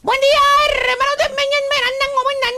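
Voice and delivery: high put-on garbled greeting; comic high-pitched, mumbled hello; voz fingida. (voz aguda fingida y farfullada)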